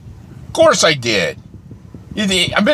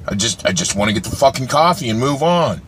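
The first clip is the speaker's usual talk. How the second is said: ordinary delivery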